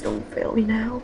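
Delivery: ordinary talk, nothing marked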